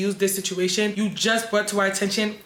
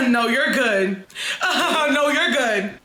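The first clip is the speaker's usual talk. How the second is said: laughing through it; 3 semitones higher, much slower than usual. Real, not acted. Laughing while retelling his reply.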